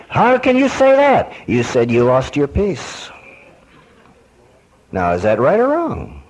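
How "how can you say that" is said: shrill incredulous squeal, much higher; mock offended churchgoer; indignant disbelief. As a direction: play the shocked sinner who can't believe it. Offended listener again.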